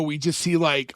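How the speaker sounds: ordinary talk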